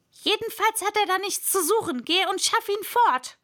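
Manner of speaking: high, fast, loud command; irate master's outburst; angry order. A narrator's reading. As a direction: order the servant off impatiently. Rabbit orders arm removed.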